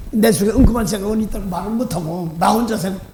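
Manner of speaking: normal talking voice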